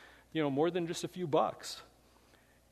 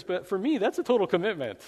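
pig's punchline; 5 semitones higher, 6 dB louder than usual. Acted. Pig voiced in a fable.

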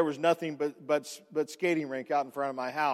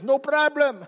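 put-on Russian accent; 8 semitones higher, 4 dB louder than usual. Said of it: "She sounds Russian".

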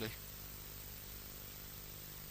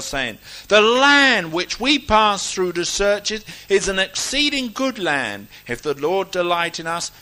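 preacher reading at full force, raised pitch; declaimed scripture reading; 4 semitones higher, 11 dB louder than usual. Real, not acted. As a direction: proclaim each phrase to a whole congregation.

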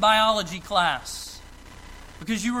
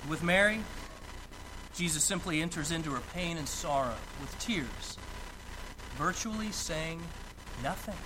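soft and tender; 4 semitones lower, 9 dB quieter than usual. Real, not acted. Describing Jesus' silent sorrow.

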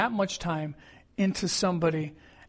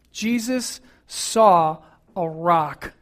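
very slow and weighty, every word set down; slow emphasis; 4 dB louder, much slower than usual. Acted. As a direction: let the rock land, slowly. Preacher's key point, drawn out.

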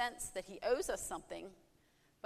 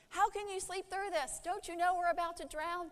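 high, frantic pleading at a sleeper; panicked disciples; 8 semitones higher than usual. Acted. Disciples shaking sleeping Jesus awake, high panicked plea. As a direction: cry out in alarm over the storm. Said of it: "shook him awake violently, saying".

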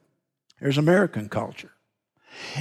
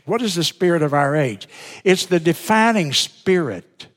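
ordinary delivery

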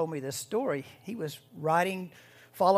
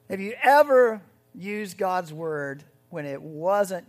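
louder, slow pressing question; emphatic slow challenge; 9 dB louder, slower than usual. Real, not acted. Preacher pressing the congregation on blasphemy, loud and slow. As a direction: lean in and press the point.

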